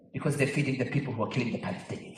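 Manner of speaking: a hint of a child, hushed and fast; soft earnest boy's murmur; small son's voice. Five-year-old son voiced in story.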